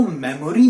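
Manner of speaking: मेमोरी in three syllables, me-mo-ree; wrong pronunciation. Shown as a demonstration. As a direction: sound the middle o in मेमोरी. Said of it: "मत बोलिए". (मेमोरी का गलत उच्चारण, मे-मो-री)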